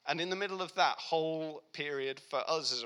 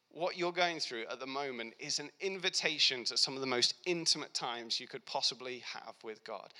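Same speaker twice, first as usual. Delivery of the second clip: ordinary talk, nothing marked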